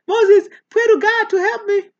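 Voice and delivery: high, loud hammed-up begging; mock desperate plea. Dying Israelites begging Moses to pray, hammed-up high plea. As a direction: beg like someone about to die. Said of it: "they say".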